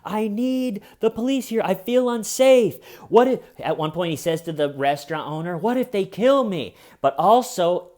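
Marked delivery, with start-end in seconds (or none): 0.0-6.8 much higher, louder frightened pleading; mock hysterical fear; panicked terror. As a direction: cry out like he's about to die. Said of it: "on the phone with the cops going".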